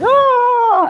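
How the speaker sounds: sudden high loud oh; a worried mom's sharp oh; worried gasp. Retold or imitated. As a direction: gasp as the frightened mom. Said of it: "her mom is like".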